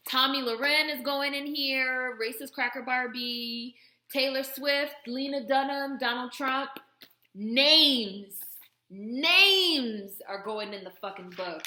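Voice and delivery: loud, punchy roll call; emphatic, loud declaring; fired-up proclamation. Real, not acted. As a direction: call out each name like a verdict, building to a shout. Gleefully declaring names for the book.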